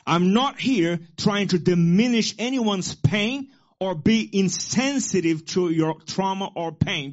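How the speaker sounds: loud, rising preacher cadence; full-force preaching. Acted. Pastor preaching to congregation, fiery sermon voice. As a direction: proclaim it to the church.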